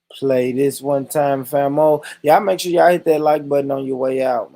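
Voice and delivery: loud hyped call to the viewers; loud hyped sign-off; hype shout-out. Real, not acted. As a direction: pump them up on the way out.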